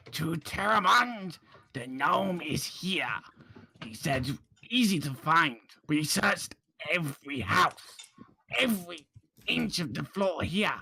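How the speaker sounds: slow, halting captive with swooping pitch; drawn-out, emphatic in-character report; captive recounting orders. Acted. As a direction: recount a fruitless search word by word. Game master voices captive recounting search.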